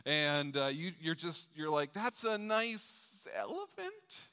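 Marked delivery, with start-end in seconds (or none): 1.9-4.2 very high, slow, sing-song parent praise; fake polite praise. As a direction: gush over a scribble you can't read. Parent guessing the child's drawing.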